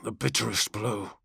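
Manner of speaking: soft and low, almost under breath; a quiet, bitter sorrow; softly. A narrator's reading. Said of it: "Kargos said softly".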